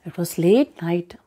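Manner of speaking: ordinary delivery, nothing marked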